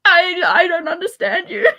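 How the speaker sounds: high, loud, laughing through the words; giggling can't-believe-it line; laughing disbelief. Retold or imitated. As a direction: crack up while saying it. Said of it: "I'm just like".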